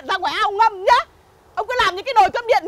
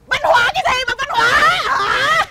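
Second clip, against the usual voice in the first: scornful shout; 5 semitones higher than usual. Acted. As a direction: throw the word back at him. Mocking neighbour's culture award. (quát mỉa mai)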